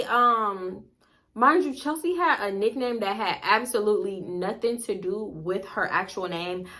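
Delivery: plain reading voice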